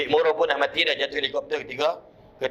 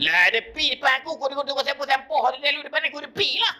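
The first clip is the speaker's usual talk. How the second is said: much higher, fast rattled-off reply; uncle's insistence; 11 semitones higher, much faster than usual. Retold or imitated. Uncle insisting to grandmother the boy never came, high and fast. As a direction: rattle it off, exasperated. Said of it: "Dia kata".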